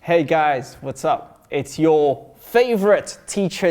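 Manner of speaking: ordinary delivery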